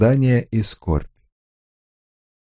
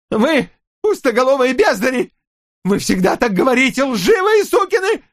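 яростный крик; 14 semitones higher than usual. Acted. Отец в ярости оскорбляет агентов.

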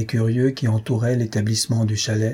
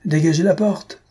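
shouted order; 6 semitones higher than usual. A narrator's reading. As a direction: clear the doorway with a shout. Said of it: "cria le maître de l'établissement".

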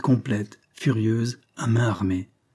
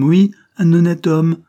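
oui, un honnête homme shouted louder and higher; shouting; 7 semitones higher, 10 dB louder than usual. Acted. Convict repeating his reproach, near shout. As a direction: hammer home un honnête homme at full volume.

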